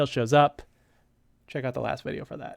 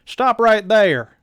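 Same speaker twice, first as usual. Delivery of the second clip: louder, higher, slow barked command; tough sergeant voice; 7 semitones higher, 13 dB louder, slower than usual. Shown as a demonstration. D J halting the creeps, loud higher sergeant bark. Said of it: "let me get that voice right".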